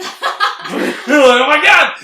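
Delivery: drawn-out, laughing disbelief; laughing oh my god. Retold or imitated. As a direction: stretch it slowly through a laugh. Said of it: "And I was like".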